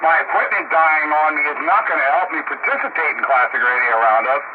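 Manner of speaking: usual speaking voice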